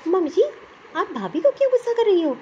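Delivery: usual speaking voice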